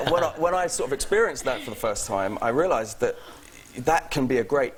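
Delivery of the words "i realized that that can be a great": ordinary delivery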